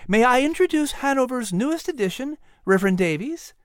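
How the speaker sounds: high woman's voice, cheerfully presenting; happy introduction. A narrator's reading. Sarah introduces the new reverend to the men, happily. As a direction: introduce the guest proudly, pitched well up. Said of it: "Sarah said happily".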